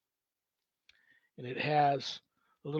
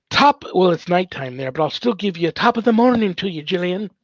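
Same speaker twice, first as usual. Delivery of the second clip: quick, hammed-up Irish accent, a bit higher and louder; 5 semitones higher, 10 dB louder than usual. Greeting Gilliam from Ireland in a mock Irish accent. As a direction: toss off a jokey Irish greeting. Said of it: "how was my Irish accent there".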